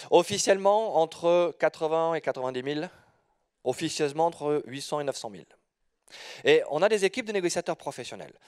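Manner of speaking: ordinary delivery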